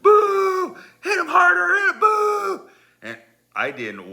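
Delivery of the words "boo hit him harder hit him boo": high, loud mock crowd shouts; mad crowd heckling. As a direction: imitate an angry mob booing. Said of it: "They're all mad".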